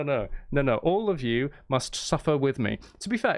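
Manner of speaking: usual speaking voice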